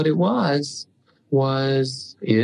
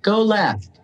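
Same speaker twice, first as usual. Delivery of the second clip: high, drawn-out call without real volume; retold yell; 9 semitones higher, much slower than usual. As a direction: call down the road, slow and high. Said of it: "So I yell down".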